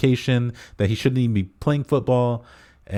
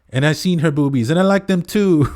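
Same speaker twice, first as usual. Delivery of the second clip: put-on higher, louder childish blurt, rising to the end; Bobby defiant; 9 semitones higher, 5 dB louder than usual. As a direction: blurt it out like a proud overgrown kid. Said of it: "he says".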